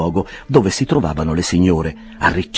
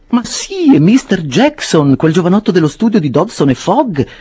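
much higher, excited landlady voice; Mrs Bardell exclaiming; 12 semitones higher, 6 dB louder than usual. A narrator's reading. Mrs Bardell to her friends, high excited exclamation. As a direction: squeal it out in delight at the clerk. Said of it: "esclamò la signora Bardell".

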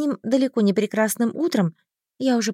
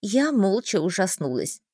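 ordinary delivery

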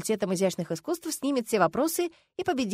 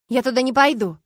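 loud, firm, raised refusal; emphatic no, pitched up; cutting refusal; 4 semitones higher, 8 dB louder than usual. A narrator's reading. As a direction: shut the idea down flatly and forcefully. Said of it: "отрезала я".

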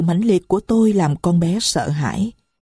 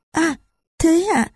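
put-on higher, small voice of a young girl; little girl's voice; 11 semitones higher than usual. Little Marie complains to the stranger, high child voice. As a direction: speak as a little child who is hurt.

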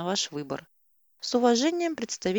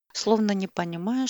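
ordinary delivery, nothing marked

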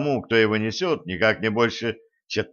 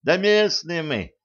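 put-on higher, drawn-out boyish answer; lightly voiced youngster, pitched up; boy's voice; 6 semitones higher, slower than usual. A narrator's reading. Village boy answering the wounded captain, lightly boyish voice.

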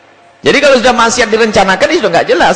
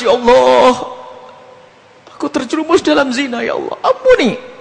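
ratapan tinggi dibuat-buat; tobat menangis; 4 semitones higher than usual. Acted. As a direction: merengek memohon ampun, pura-pura menangis. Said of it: "Tobat menangis". (menangis pura-pura dengan suara meninggi)

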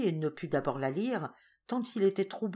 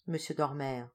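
ordinary delivery

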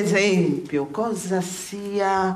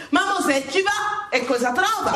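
shout; 8 semitones higher than usual. Acted. Rhetorical climax of sermon.

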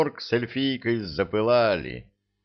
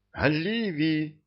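high, slow anguished cry out; desperate cry; 9 semitones higher, slower than usual. A narrator's reading. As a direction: cry out in despair. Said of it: "в отчаянии вскрикнул Фортель". (отчаянный вскрик)

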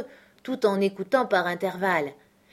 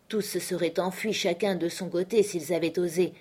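ordinary delivery

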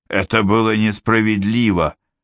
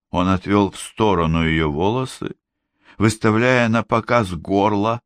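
plain reading voice

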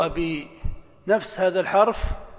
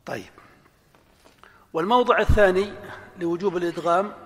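ordinary talk

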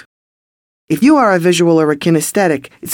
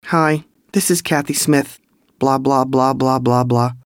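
flat, lower, droning sales pitch; boring monotone; 4 semitones lower than usual. Shown as a demonstration. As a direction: drone it out like you don't care. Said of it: "That's boring".

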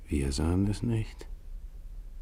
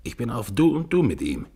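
higher, louder unhinged voice; raving madman; 6 semitones higher, 7 dB louder than usual. Acted. Evans raving about the storm, unhinged. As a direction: boast like a man gone mad.

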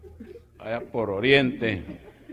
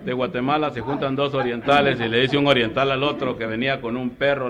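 ordinary talk, nothing marked

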